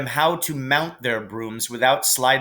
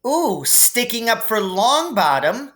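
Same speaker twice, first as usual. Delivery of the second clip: much higher, teasing sneer; mocking Slytherin girl; 10 semitones higher than usual. A narrator's reading. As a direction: taunt her with a nasty ooh. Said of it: "said Pansy Parkinson".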